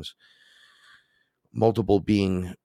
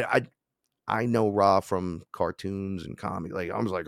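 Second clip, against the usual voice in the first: normal talking voice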